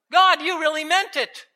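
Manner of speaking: high-pitched astonishment at God; amazed realization. Acted. Believer marvels that God meant it, amazed. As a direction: exclaim it as a sudden discovery.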